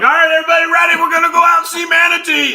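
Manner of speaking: high, full-volume mock shout; captain imitation. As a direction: holler to a whole boat like an over-excited captain. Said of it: "he was like".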